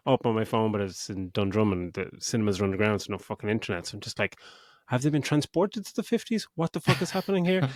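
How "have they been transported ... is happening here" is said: mock bewilderment. Retold bewilderment at the film.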